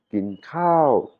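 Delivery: "kin kao" with a long high arch on "kao"; rising high tone. Shown as a demonstration. Teacher repeats the phrase for students, high tone on "kao". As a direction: stretch "kao" up high, then down. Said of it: "un son montant aigu".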